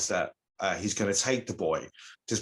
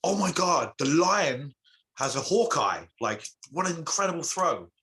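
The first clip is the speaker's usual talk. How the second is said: amazed; 7 semitones higher than usual. Acted. Mother marvels at boy's throw.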